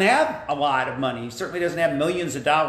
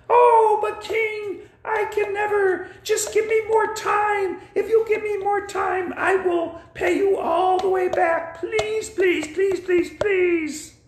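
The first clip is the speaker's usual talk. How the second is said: desperate begging; slower than usual. Acted. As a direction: cry out please, please in a squeaky high voice. Debtor begs for more time.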